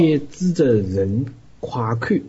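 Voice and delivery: slow, drawn-out Zhejiang dialect. Shown as a demonstration. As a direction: round off the verse in dramatic Zhejiang speech. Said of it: "用浙江话念".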